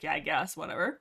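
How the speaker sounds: low, dismissive mumble; a dull, can't-be-bothered yeah; bored shrug. Retold or imitated. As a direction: brush it off, uninterested. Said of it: "she's like".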